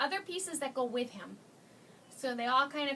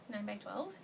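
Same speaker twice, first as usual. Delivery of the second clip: soft aside; 4 semitones lower, 7 dB quieter than usual. Real, not acted. Repeating the size as an aside.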